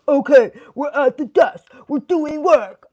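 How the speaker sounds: slow, loud, put-on businesslike voice; mock serious worker. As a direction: pretend to be all strict about work time. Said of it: "instead of being like".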